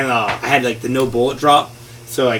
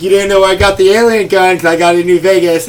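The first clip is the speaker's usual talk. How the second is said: smug taunt; 7 semitones higher, 7 dB louder than usual. Retold or imitated. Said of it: "I'm like".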